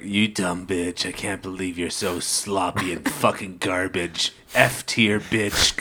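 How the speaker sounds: contemptuous sneer. Retold or imitated. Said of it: "Sasori's like".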